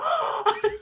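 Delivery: high-pitched delighted gasp; imitated excited gasp. Reader imitates him seeing turquoise water, excited gasp. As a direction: gasp as if seeing something lovely. Acting out an excited character's reaction.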